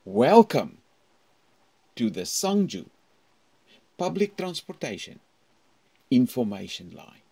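loud, measured phone-line announcement. Shown as a demonstration. Teacher demonstrating a recorded phone greeting. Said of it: "So what you do is".

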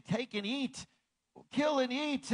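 lightly voiced divine command; voice of God commanding. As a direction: call down the command from heaven. Voice from heaven commands Peter.

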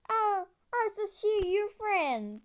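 usual speaking voice

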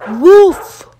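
loud high woof; dog bark. Acted. Dog trampling the sandcastle, loud bark.

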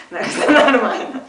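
loud burst of laughter on the words; laughing. Real, not acted. Laughing off writing poems on Twitter. (сквозь громкий смех)

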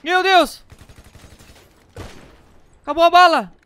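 high loud frightened yells; panicked shouting. Real, not acted. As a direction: shout in real panic at the screen. Panicked under fire in game. (gritos de pânico)

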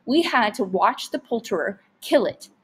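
usual speaking voice

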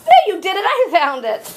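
high, loud teasing denial; playful protest. Real, not acted. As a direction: cry out in mock protest. Mom playfully disputes who found it.

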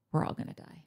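very soft, almost voiceless aside; hushed aside. Real, not acted. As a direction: drop it to near nothing. Dark aside after a pause.

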